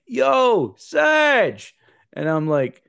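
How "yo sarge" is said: put-on much higher, loud drunken yo sarge; drunk yell. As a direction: bellow it down the hallway. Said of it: "And he's like".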